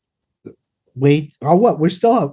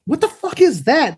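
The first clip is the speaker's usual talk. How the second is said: high shocked exclaim; startled; 10 semitones higher than usual. Retold or imitated. Spotting a huge rabbit on the walk, startled. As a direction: gasp at the giant rabbit. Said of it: "I'm like".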